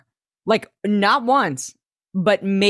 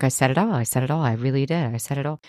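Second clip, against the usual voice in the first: mock muttered boast; 5 semitones lower, 3 dB quieter, much faster than usual. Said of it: "she's like".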